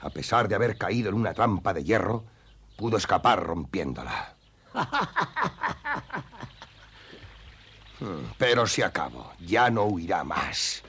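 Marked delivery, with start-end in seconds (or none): 4.7-6.6 high, mocking villain laugh; gloating laugh. Hunter gloating over the wounded bear, high evil laugh. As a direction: laugh as if the prey is already caught.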